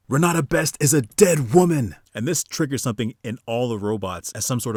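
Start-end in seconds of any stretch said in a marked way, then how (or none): 0.1-2.0 loud, high-pitched yell; screamed threat. Acted. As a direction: shout it out as a furious battle cry. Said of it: "And so she screams".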